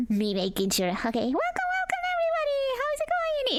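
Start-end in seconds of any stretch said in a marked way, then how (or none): 1.3-3.6 high sing-song welcome; excited welcome. Real, not acted. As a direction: greet them way up high. High-pitched stream welcome.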